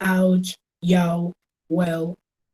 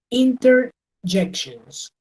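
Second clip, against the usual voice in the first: slow drawn-out term; 3 semitones higher, much slower than usual. Shown as a demonstration. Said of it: "Next, we have".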